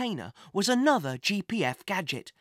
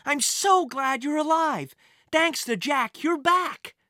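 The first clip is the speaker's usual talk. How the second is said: joyful; 8 semitones higher, 4 dB louder than usual. Acted. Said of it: "Thomas shouted with joy".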